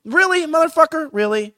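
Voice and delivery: loud, high mock disbelief; incredulous. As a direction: throw his outrage back in his face. Retorting to the pundit.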